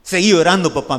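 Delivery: loud mocking rebuke from the boss; a loud boss telling him to keep on crying; stern boss. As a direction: bark it at a crying man. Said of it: "así me dijo, claramente".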